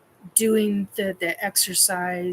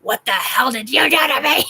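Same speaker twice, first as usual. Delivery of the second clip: loud, higher mock-accusing yell; outraged shout; 4 semitones higher, 9 dB louder than usual. Client confronts her trainer, outraged shout. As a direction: confront him at full volume.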